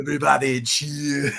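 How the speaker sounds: villain one-liner, Austrian-tinged; Schwarzenegger impression. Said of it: "Pretty good impression".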